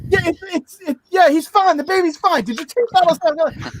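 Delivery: high-pitched, impatient imitation; drugged-up wife. Wife on the phone dismissing baby questions, mock frantic insistence. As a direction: brush off the baby talk and press the real question. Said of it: "she was all drugged up".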